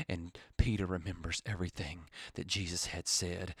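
very soft, low, fading into a whisper; hushed storytelling. Acted. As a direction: let the sermon sink to a grave hush. Hushed retelling of Peter's fall.

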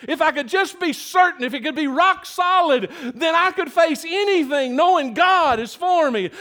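high, emphatic longing to be sure; imagined doubter's earnest wish; wishing for certainty. Acted. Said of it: "Paul was saying".

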